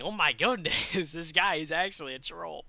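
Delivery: normal talking voice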